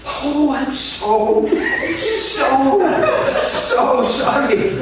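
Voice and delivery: slow, high, laughing apology; embarrassed apology. Retold or imitated. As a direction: stretch out the sorry, mortified. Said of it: "And she says".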